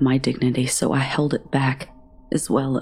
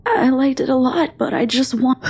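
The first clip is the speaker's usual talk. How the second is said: high and louder, rushed reassurance; anxious pleading; 11 semitones higher, 4 dB louder than usual. A narrator's reading. Queasy girl insisting to Stanley she liked it, anxious. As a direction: hurry to convince him, panicking.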